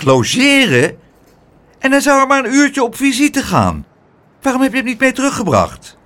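high, fussy scolding voice; upset guardian demanding answers; indignant aunt. Acted. Aunt scolds Pluk for not bringing the boy back, high and indignant.

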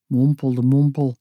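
put-on sulky boy mumbling under his breath; low sulky mumble; mumbled line. A narrator's reading. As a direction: mumble it like a grumpy teenager. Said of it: "zei hij".

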